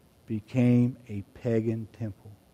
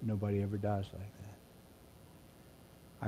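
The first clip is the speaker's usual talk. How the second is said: hushed; 2 semitones lower, 15 dB quieter, slower than usual. Real, not acted. Quiet awe after the shout.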